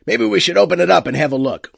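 loud, callous captain; captain's voice. Acted. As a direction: brutal ship captain. Captain threatening the wub.

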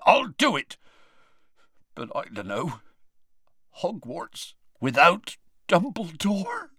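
rough giant voice, choked up; big man holding back tears; tearful Hagrid. Acted.

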